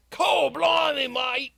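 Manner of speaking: mock Australian shout. Imitating the game character Yangus, a loud hammed-up Aussie shout. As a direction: shout it like a cartoon Aussie bloke. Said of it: "He's like".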